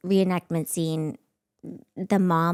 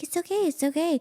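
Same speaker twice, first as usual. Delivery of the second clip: much higher, gentle soothing; soothing husband; 9 semitones higher than usual. Retold or imitated. Husband soothing his frightened wife in bed, gentle and higher.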